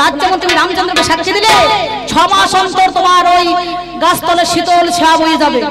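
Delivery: loud, ringing pronouncement; proclaimed blessing. Acted. Sita blesses the banyan for bearing witness, proclaimed loudly. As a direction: bestow a boon at full voice.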